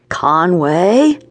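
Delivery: name called on a long rising question; calling out. A narrator's reading. Boss calling for Conway in the dark, rising call. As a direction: call the name out, sliding up at the end.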